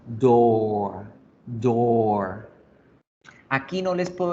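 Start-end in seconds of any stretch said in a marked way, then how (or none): 0.1-2.5 "door" with a long drawn O; long held vowel in "door". Shown as a demonstration. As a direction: stretch the O in "door" a little. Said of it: "yo la alargo tantico y digo door".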